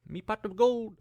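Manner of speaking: high-pitched, shaky attempt at Irish; hammed-up Irish that misses the mark; attempted Irish accent. Shown as a demonstration. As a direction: try out a leprechaun's Irish accent. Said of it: "I can do an Irish accent".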